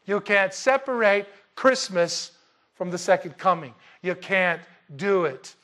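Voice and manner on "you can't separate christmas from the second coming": ordinary talk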